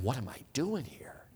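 very soft nervous murmur to yourself; nervous inner voice. Retold or imitated. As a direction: mutter it under your breath in dread. Inner panic at roller coaster line.

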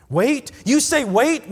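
high, loud, incredulous protest; indignant. A narrator's reading. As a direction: throw it back as an outraged question. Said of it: "my indignant reply".

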